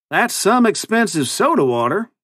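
high-pitched folksy cowboy twang; cowboy accent. A narrator's reading. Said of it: "his ridiculous new accent".

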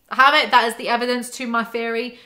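loud gloating gotcha; triumphant. Real, not acted. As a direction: crow over proving it.

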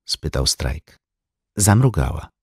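ordinary delivery, nothing marked

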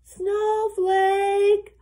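high, sung-out, sustained shout; searching call. A narrator's reading. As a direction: stretch the name out across the storm. Shepherd calling for lost lamb.

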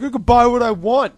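high, loud bratty protest; imitating a kid yelling in defiance; retold tantrum. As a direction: protest like an outraged underage kid. Acting out the kid's tantrum.

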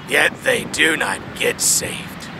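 ordinary talk, nothing marked

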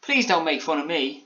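Alan Taylor. A narrator's reading. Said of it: "said Alan Taylor".

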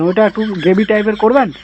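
ordinary talk, nothing marked

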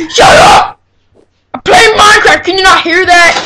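loud, high angry yell; shouting. Real, not acted. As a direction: yell back in annoyance. Yells back at brother.

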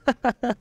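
high, loud acted laugh; short ringing burst of laughter. A narrator's reading. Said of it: "Marigold se znovu zvonivě rozesmál". (zvonivý smích)

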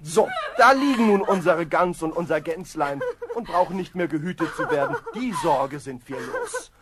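laughing through the words; husband laughing off the broken honey jug. Acted. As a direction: chuckle it off, half amused.

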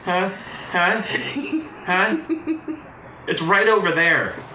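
high, loud put-on hero voice; voicing the hero. Voicing the hero to the woman, high and loud. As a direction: pipe up brightly as the helpful hero.

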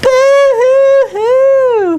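stretched-out, high pretend boo-hoo; mock sobbing boo-hoo. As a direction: wail it like a cartoon chorus. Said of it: "cried Bird's friends together".

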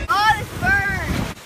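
slow loud pained cry. Acted. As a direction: wail from sunburn. Sunburn pain.

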